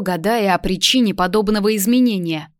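plain reading voice

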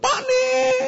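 put-on high child's voice crying out; high whiny cry from a child; demanding child. Child demands souvenirs from father, high whiny cry.

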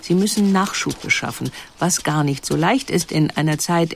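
ordinary talk, nothing marked

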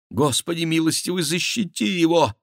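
high woman's pleading prayer; praying. A narrator's reading. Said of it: "молилась Диана". (высокая умоляющая молитва)

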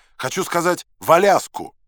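loud, high shout; high, forceful yell. A narrator's reading. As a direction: holler it to a deaf listener. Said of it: "заорал я опять".

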